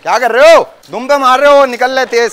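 loud, high scolding yell; angry shout across the crowd. Real, not acted. Shouting at kids hitting goat.

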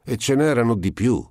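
high-pitched exclamation; exclaiming. A narrator's reading. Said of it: "esclamò Simone Lampo". (esclamazione a voce alta di tono)

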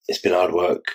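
ordinary talk, nothing marked

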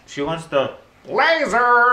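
high, drawn-out silly yelling; weird voice. Real, not acted. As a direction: blurt it out high and stretched. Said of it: "you can't even do it".

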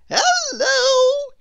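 very high, loud, exuberant character; Mister Tickle. A narrator's reading. Mister Tickle greets Mister Tall, high and jolly. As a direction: sing out a long, cheery greeting. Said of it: "Mister Tickle came along".

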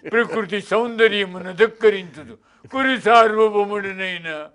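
slow, high comedic Relangi delivery; Relangi impression. Shown as a demonstration. Mimic recites the line as comedian Relangi. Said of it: "Relangi చెప్పాడు".

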